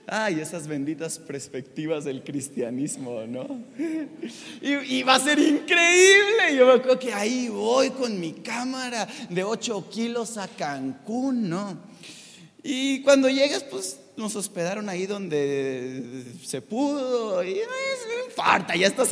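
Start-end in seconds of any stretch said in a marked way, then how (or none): none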